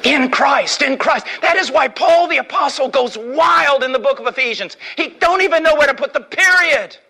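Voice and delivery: preacher shouting praise of Christ; full-force preaching. Real, not acted. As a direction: build to a fiery pulpit climax. Preaching climax about Christ.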